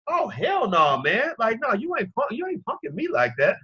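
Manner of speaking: high-pitched, acted outrage; indignant refusal. Host modelling how men should react when triggered, acted indignation.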